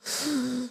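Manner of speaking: quick, soft, breathy gasp of surprise. Real, not acted. Reacting to a chat comment with a soft gasp. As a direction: gasp as if shocked.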